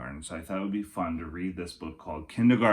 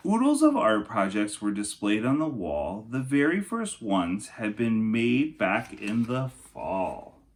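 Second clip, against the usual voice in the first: storybook narration for kindergartners; lilting rhyming picture-book voice; reading to children; 4 semitones higher, 5 dB louder, slower than usual. Acted. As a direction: read it like story time for little kids. Reads Night Before book aloud.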